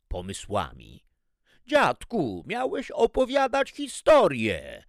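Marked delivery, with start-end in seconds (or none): 1.7-4.8 put-on high, loud, outraged kids; indignant children. A narrator's reading. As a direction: protest like impatient children. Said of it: "oburzyły się dzieci". (oburzone dzieci)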